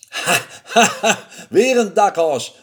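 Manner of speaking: gleeful mocking chuckle; laughing at yet another fool; laughing mouse. A narrator's reading. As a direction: laugh into the words. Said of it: "lachte de muis".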